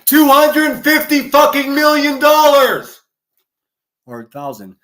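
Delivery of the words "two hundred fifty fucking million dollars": high, loud, slow outraged yell; furious bellow of the dollar amount; full shout. Shown as a demonstration. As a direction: scream the number at the audience. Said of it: "you gotta say it like this".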